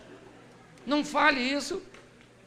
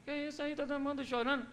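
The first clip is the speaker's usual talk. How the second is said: gentle question; 3 semitones higher, 9 dB quieter than usual. Retold or imitated. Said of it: "Chegou e falou".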